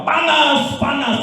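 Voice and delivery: high yelp of someone burning; imitated demon cry. Preacher imitating the possessed person screaming of heat, high mock yell. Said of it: "Tiba-tiba dia berteriak".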